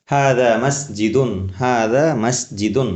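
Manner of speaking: each word laid out slowly; teacher repeats the Arabic slowly to drill it; slow model answer. Shown as a demonstration. Teacher drilling Bengali students, slow careful Arabic. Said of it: "Bangla রূপে কি হবে?".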